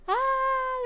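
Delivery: excited gasp. Real, not acted. Gasp at intro.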